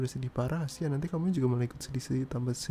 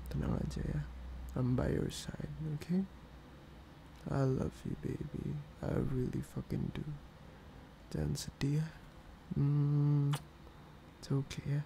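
softer, reassuring, close to a whisper at times; soft reassurance; 7 dB quieter, slower than usual. Real, not acted. Comforting his upset partner after the prank, soft and tender. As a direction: comfort her gently, softer.

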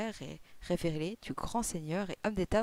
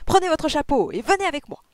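high, loud, excited order; rally your friend to leave at once; urgent cry; 9 semitones higher, 10 dB louder than usual. A narrator's reading. Detective hurrying his friend out, crying out. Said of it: "s'écria-t-il".

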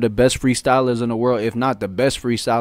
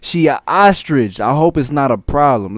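higher, louder, slow and hyped rapping the line back; excited lyric quote; 5 semitones higher, 7 dB louder than usual. Retold or imitated. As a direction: savour each word as if it's genius. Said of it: "He said".

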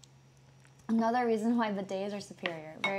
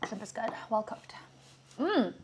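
usual speaking voice

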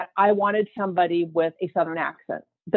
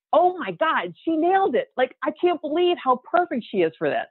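retold excitement, pitched higher; higher-pitched amazed reaction; 7 semitones higher than usual. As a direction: gush in disbelief, recalling the moment. Retold amazement at hearing audition.

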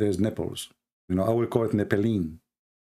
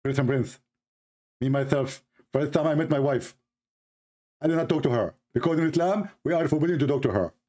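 lightly voiced preacher, a touch higher; Zakir Naik impression; 4 semitones higher than usual. Host mimics preacher answering questions, slightly higher. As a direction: play a preacher answering earnestly. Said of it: "I can make it a voice of Zakir Naik".

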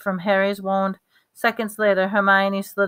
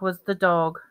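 ordinary delivery

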